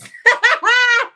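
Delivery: high, loud cackle; loud laughter. Real, not acted. Host cracks up at old people business, loud cackle. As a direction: burst out laughing, high and hard.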